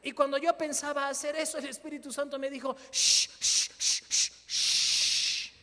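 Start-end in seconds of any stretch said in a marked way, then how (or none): 2.9-5.5 loud whispered shh shh; urgent whispered hushing. Retold or imitated. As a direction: hush him firmly with a long shh. Holy Spirit hushing him.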